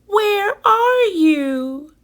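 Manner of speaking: high, tiny, far-off squeak; a lost baby bat calling for mother; squeaky baby bat. A narrator's reading. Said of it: "Stellaluna squeaked".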